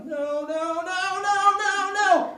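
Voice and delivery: most dramatic. Retold or imitated. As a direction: imitate a wife's over-the-top cries of distress. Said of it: "just the most dramatic".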